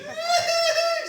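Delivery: very high, loud comic wail; mock loud crying. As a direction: wail like a hammed-up crying wife. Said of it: "she starts crying loud".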